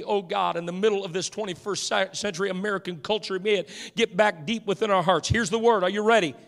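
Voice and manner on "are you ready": ordinary talk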